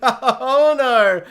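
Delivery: ordinary talk, nothing marked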